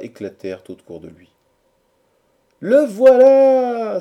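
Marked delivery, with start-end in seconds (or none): 2.6-4.0 high, drawn-out shout of greeting; joyful cry. A narrator's reading. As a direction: shout it long and high like a cheering crowd. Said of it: "s'écrièrent à la fois dix-sept voix". (cri de joie aigu et prolongé)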